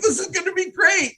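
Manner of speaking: mock excited, pitch shooting way up; high-pitched, gushing imitation of excitement; all excited. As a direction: imitate an over-eager team captain. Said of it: "he was all excited, like".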